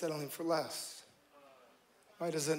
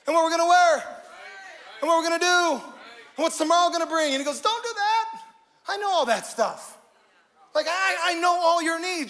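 loud, higher preacher's voice; full-force preaching; 8 semitones higher, 10 dB louder than usual. Acted. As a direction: drive the point home to the crowd.

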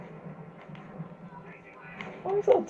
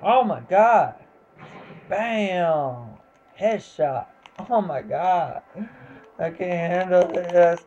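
ordinary talk

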